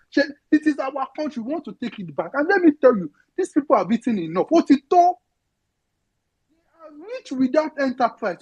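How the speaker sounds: usual speaking voice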